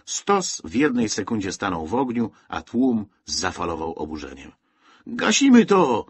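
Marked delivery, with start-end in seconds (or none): 5.1-6.0 loud and much higher, indignant; rally the crowd to put out the fire; calling out. A narrator's reading. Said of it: "zawołał ktoś". (głośne, wysokie wołanie z tłumu)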